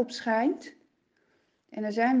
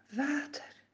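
soft echo; 3 semitones higher, 7 dB quieter than usual. Acted. Echoed softly.

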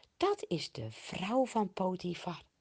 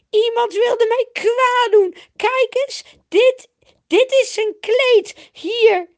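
very high, loud hammed-up wail; pitiful screaming; 15 dB louder than usual. A narrator's reading. Potifar's wife shows the cloak to the men, wailing shrilly. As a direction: accuse shrieking, as the wronged victim. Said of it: "ze doet nou opeens heel zielig".

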